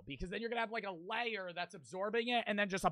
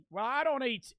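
higher-pitched mock British accent; British accent attempt; 6 semitones higher, 3 dB louder than usual. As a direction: launch into a put-on British voice. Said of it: "prepare my British accent".

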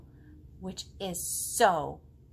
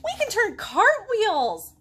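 much higher, louder, slow; eager kid; 11 semitones higher, 9 dB louder, slower than usual. Acted. Girl in the book boasting to the potato, high and loud. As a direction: show off proudly. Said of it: "girl boasts".

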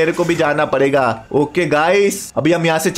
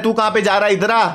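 usual speaking voice

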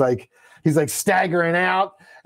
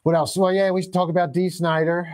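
ordinary talk, nothing marked

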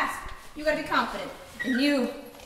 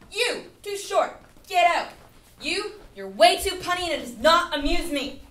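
barking gym coach; 4 semitones higher, 7 dB louder than usual. Acted. Judging auditioners harshly.

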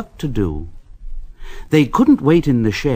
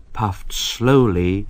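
slow, softer, dropping lower; hushed suspense; 4 semitones lower, 5 dB quieter than usual. A narrator's reading. Narrator building dread, slow and soft. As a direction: draw out the dark, dark tunnel.

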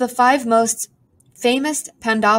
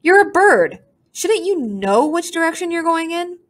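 put-on cheeky girl; 9 semitones higher than usual. A narrator's reading. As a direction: tease the bird. Aru mocks the bird.

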